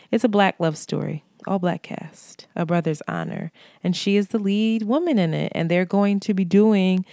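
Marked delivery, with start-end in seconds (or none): none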